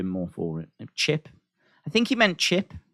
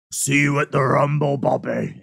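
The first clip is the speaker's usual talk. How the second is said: a lower, more serious Brock; face-to-face Brock; 5 semitones lower than usual. Retold or imitated. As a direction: say it as if he is right in front of you. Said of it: "It's like that when I see him face-to-face".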